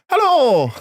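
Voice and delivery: high, loud presenter hello, falling away. Shown as a demonstration. Repeating the greeting for co-host, high loud TV hello. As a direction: give a big showy TV greeting. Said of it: "That was my T V hello".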